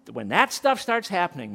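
ordinary talk

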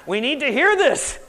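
loud, high emphatic urging; worked up. Real, not acted. Preacher telling folks they must hear this, loudly worked up. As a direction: push it out forcefully to the room. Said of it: "I'm getting worked up now".

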